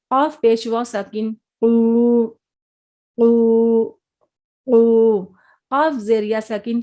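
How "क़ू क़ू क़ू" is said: क़ू with a thick back-of-throat Q, long oo, loud; full-mouthed heavy qaf. Shown as a demonstration. Teacher drilling heavy letters, thick full-mouthed क़ू. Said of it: "इसको भी मुंह भर के पढ़ेंगे".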